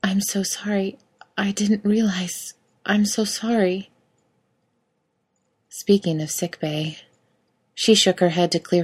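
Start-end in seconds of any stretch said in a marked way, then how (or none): 0.0-3.9 hushed, half-whispered anguish; a breathy cry of remorse; horrified apology. A narrator's reading. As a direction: apologize slowly, in understated horror. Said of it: "she cried in horror at what she had wrought".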